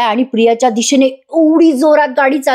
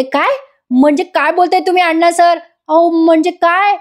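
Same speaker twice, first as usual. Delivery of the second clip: alarmed; 6 semitones higher than usual. Retold or imitated. Frightened heroine confronts villain.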